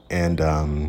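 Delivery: ordinary talk, nothing marked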